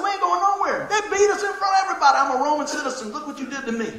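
indignant apostle standing his ground; defiant Paul. Retold or imitated. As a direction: play Paul refusing to leave.

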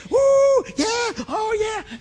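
high whooping cheer, hammed-up; mock fan cheer. Imitating cheering football fans.

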